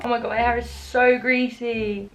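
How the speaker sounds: usual speaking voice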